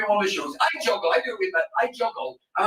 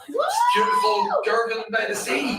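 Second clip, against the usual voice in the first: mock-grand. Mock-grand praise of Girvan.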